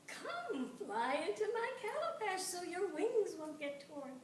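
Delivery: high, loud coaxing call to the hornets; coaxing trickster. A narrator's reading.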